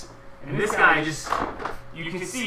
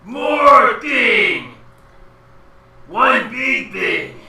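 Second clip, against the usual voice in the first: shouting giant; 7 semitones higher, 9 dB louder, much slower than usual. Acted. Giant calls out the intruders.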